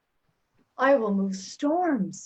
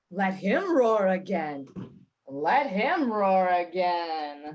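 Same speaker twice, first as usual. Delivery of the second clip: lordly Duke voice; 5 semitones lower, slower than usual. Retold or imitated. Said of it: "make the Duke say".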